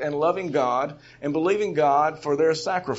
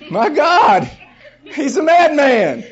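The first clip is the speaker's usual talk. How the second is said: higher, louder and slow, half laughing in disbelief; shocked disciple; 7 semitones higher, 7 dB louder, much slower than usual. Retold or imitated. Disciple stunned by Jesus' rampage, slow laughing disbelief. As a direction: gasp it out like a stunned follower.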